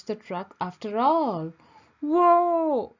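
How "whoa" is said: high, loud whoa as the hose throws him; startled shout. A narrator's reading. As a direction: shout in shock. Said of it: "he shouted".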